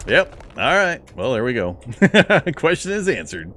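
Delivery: relieved little cheer; excited. Real, not acted. Relief after the fight.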